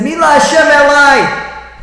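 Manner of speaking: high, loud proclamation; ringing call. Retold or imitated. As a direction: cry it out as a summons. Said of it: "and he said".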